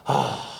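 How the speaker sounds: exhausted exhale. Retold or imitated. Collapsing after last rep.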